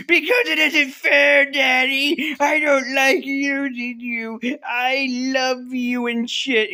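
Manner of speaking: put-on high, loud whiny kid voice; hammed-up childish tantrum; five-year-old's tantrum. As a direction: wail it like a little kid, drawing out the words. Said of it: "five-year-old territory and throws a tantrum".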